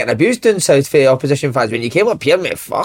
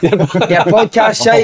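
heckler yelling; 5 semitones higher, slower than usual. Retold or imitated. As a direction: bark "podcast shite" up high like a fan on the touchline. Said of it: "a guy for ninety minutes just kept going".

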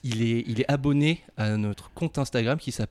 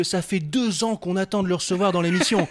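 upbeat presenter read; show host intro; 7 semitones higher than usual. Acted. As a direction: hype up the guest like a host.

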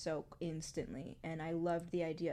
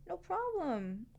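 much higher, sing-song comforting voice; soothing parent; 11 semitones higher, 6 dB louder than usual. Pretend. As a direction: coax a fallen child back up. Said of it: "It's like".

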